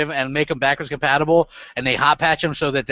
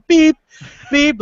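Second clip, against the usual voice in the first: high, short electronic beep; punchy beep sound effect; imitated notification beep; 9 semitones higher, 6 dB louder than usual. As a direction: mimic a console alert beeping. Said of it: "my damn thing's like".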